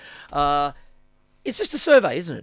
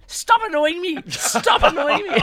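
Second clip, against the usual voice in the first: high-pitched talking doll of a little woman; little woman's voice; 11 semitones higher, slower than usual. Pretend. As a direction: squeal it like a pull-string toy woman. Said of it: "this little woman's voice".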